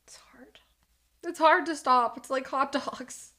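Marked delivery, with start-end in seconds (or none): 0.0-0.6 whispered, barely breathed. Real, not acted. Whispered confession to the chat about hot dogs. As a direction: breathe it out as a secret.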